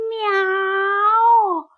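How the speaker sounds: high, long meow; drawn-out cat meow. A narrator's reading. Someone by the pond meows at the puppy, high meow. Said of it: "опять кто-то сказал".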